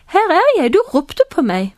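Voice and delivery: high-pitched start, easing down; boy answering a call. Acted. Boy gets up and goes to priest Eli, high eager reply. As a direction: report eagerly, voice leaping up first.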